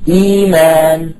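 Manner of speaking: slow, stretched "إيمان" with long "ee"; sustained smooth vowels in "إيمان"; hamza replaced. Shown as a demonstration. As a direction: hold eee-maan for "إيمان" evenly, no inner glottal stop. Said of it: "هي بالإبدال".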